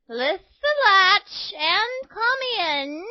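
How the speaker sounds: high, slow falsetto granny; wolf playing granny. A narrator's reading. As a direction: coax in a put-on granny voice. Wolf poses as grandmother.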